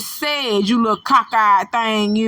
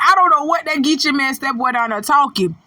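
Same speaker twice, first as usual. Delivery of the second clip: normal talking voice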